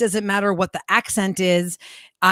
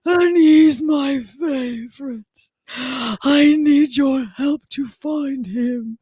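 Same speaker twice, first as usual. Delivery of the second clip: strained, very slow and higher; straining Margaret Thatcher; 5 semitones higher, much slower than usual. Acted. Co-host imitating an actress's Thatcher for the radio crew, straining to talk. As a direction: labour over each word, as if talking hurts. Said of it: "she's straining to talk".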